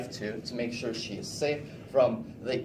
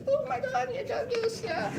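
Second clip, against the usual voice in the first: very high-pitched mock kid excitement; excited squeal. Child thrilled on waking to Christmas gifts, squeaky high voice. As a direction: squeak it out way up in falsetto. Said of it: "your voice goes, like, three octaves higher".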